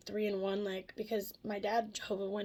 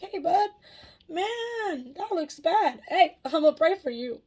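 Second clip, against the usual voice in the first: much higher and louder, breezy fake concern; loud, high, mocking offer to pray; mock cheery bystander; 8 semitones higher, 10 dB louder, slower than usual. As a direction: play the useless neighbour chirping at a burning house. Mocking a useless onlooker.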